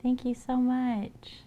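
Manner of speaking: high, drawn-out delighted thank-you; squealed thanks. Real, not acted. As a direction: gush slowly over the gift. Thanking a viewer warmly.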